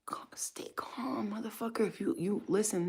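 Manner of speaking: hushed, high, breathless plea; panicked whisper. Pretend. Mocking a scared friend under fire, a high panicked whisper. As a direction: whisper it like you're terrified.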